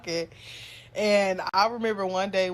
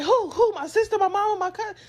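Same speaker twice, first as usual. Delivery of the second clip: high, urgent string of questions; panicked mom; 11 semitones higher, 4 dB louder than usual. Retold or imitated. Mom asking her kid who died, panicked. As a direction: imitate a mother rattling off guesses in alarm.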